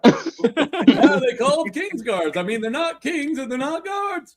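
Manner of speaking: high loud observational comic; Seinfeld voice. Pretend. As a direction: riff like Seinfeld. Seinfeld-style bit.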